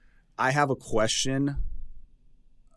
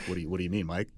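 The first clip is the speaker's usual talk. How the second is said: suddenly grave, much lower and softer; a hint of a worried Kenny; serious, low and soft; 6 semitones lower, 9 dB quieter, much faster than usual. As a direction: go low and quiet, taken aback. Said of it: "He's gonna get so serious".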